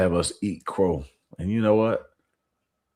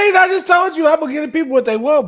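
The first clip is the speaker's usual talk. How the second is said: excited protest; 10 dB louder than usual. Real, not acted. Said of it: "defending himself, exclaiming".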